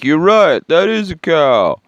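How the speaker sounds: high, loud exclamation as a whole crowd; slowing into a drawn-out mock marvel; mock amazed crowd. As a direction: exclaim it like an astonished crowd, hammed up. Voices a crowd in his story.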